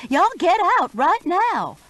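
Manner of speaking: high and loud, urgent order to flee; screaming at the neighbors to get out; frantic yell. A narrator's reading. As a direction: shout them out of the burning house. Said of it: "I screeched".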